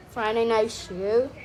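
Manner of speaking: normal talking voice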